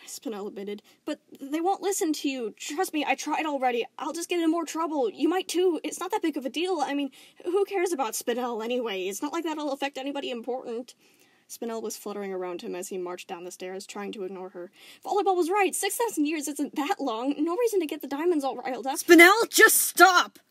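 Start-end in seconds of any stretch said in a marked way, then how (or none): 1.0-10.9 high, louder, pleading; anxious pleading. Acted. Spinel pleading with Steven, anxious. 15.0-19.0 high, loud nervous pleading; frantic pleading. Acted. Spinel pleading while Steven marches, frantic. 19.0-20.4 high, very loud shout; outburst. Acted. Steven snapping at fluttering Spinel, shout. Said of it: "Steven burst out".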